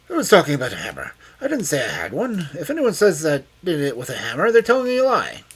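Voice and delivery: high, loud, heated protest; angry denial. A narrator's reading. Cornered old man denying the hammer, angry. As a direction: deny it loudly, voice climbing.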